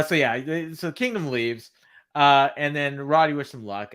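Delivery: usual speaking voice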